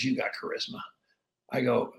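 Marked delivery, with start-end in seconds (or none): 0.0-0.8 hushed confiding. Retold or imitated. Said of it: "He goes".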